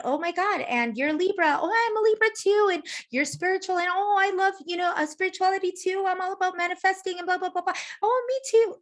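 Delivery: high-pitched, racing mock gushing; retold excited bonding. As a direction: pile on delighted agreement, hammed up. Re-enacts excited exchange of common interests.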